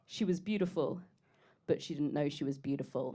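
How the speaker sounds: a low, quiet mock of a smug man; lightly voiced guy, low and soft. Mocking what men say about women: low, quiet smug guy. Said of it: "the worst thing a guy can say".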